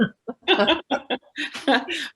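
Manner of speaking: high, bubbly burst of laughter; amused laugh. Real, not acted. Guest tells host about crystal-filled warehouse, amused high laugh. Amused by own story's punchline.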